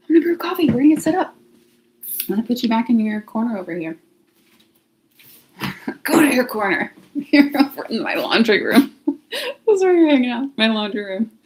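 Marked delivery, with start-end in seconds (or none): 0.1-1.4 high, loud upbeat announcement; pumping herself up to start; excited. Real, not acted. About to brew coffee and set up, loud and high excited. 5.6-11.3 laughing while teasing the camera; giggling at her own joke. Real, not acted. Putting the camera in the laundry room, laughing and teasing.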